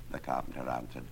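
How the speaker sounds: plain reading voice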